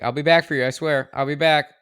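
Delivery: loud, earnest promise; loud vow. Acted. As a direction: call it out like a vow. Vowing to return, acted quote.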